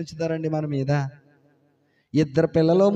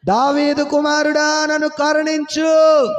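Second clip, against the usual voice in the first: high, slow shouted plea; crying out louder; 8 semitones higher, 5 dB louder, slower than usual. Retold or imitated. Beggar shouting louder to Jesus despite rebukes, slow high shout. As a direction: shout the plea over the crowd. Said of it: "వాడు".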